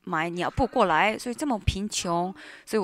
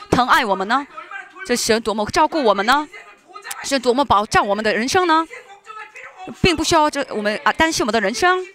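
loud, driving sermon cadence; full-force preaching; 4 semitones higher, 6 dB louder than usual. Acted. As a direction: preach it out to the congregation.

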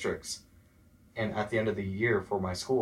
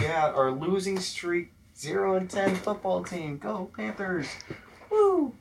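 sarcastic cheerleader, much higher, ending in a shrill whoop; mock pep-rally cheer; 10 semitones higher, slower than usual. Mocking the school for praising its losing football team, sarcastic cheer. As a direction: hype the losing team with fake enthusiasm, slow at first.

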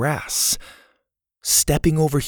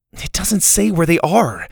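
put-on higher-pitched, frustrated young heroine; mild frustration; 6 semitones higher, 3 dB louder than usual. A narrator's reading. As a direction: let some frustration lift the pitch. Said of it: "she said with some frustration".

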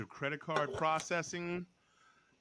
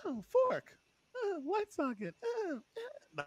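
put-on curious little kid; 13 semitones higher, 4 dB quieter than usual. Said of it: "a little girl just is not".

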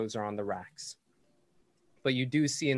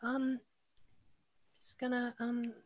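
put-on shy little girl; 13 semitones higher than usual. Shy girl caught by friends.

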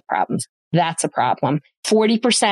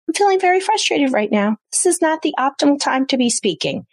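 pitched higher, owning the frustration evenly; name your feeling instead of yelling, higher up; calm frustrated statement; 8 semitones higher than usual. Shown as a demonstration. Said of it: "you can say".

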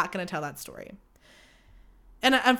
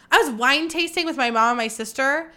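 usual speaking voice

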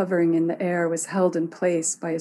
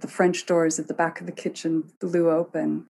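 ordinary delivery, nothing marked

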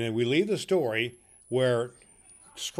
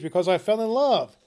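raised high and bright with feeling; earnest, high; 8 semitones higher, 5 dB louder than usual. Acted. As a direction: blurt it out proudly. Nephew's earnest answer.

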